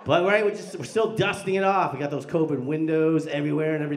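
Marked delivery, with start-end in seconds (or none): none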